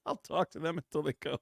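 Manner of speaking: usual speaking voice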